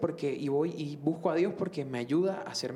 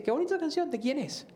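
much higher, lilting imitation of an admiring listener; imitated admiration; 11 semitones higher than usual. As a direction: voice a nun's delighted curiosity, pitched high. Monjita admira la canción, retold. (imitando admiración con voz aguda)

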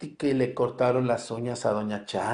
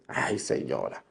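sigh at a lady; 10 semitones lower, 4 dB quieter than usual. Pretend. Answering a parishioner's complaint.

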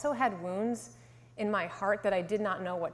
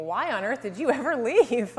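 mildly mock disbelief, lilting with a laugh; incredulous thought; 3 semitones higher, 6 dB louder than usual. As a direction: act out a baffled inner question. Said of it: "I was looking at him thinking".

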